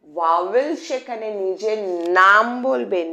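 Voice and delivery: slow, clear dictation pace. Shown as a demonstration. Teacher dictating a rule slowly to students. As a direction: spell it out slowly so they can write. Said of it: "slow dictation repeated".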